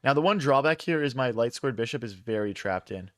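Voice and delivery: ordinary talk, nothing marked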